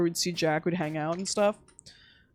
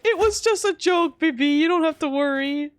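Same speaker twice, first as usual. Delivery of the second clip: teasing reassurance; 8 semitones higher, 5 dB louder than usual. Retold or imitated. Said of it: "I'm like".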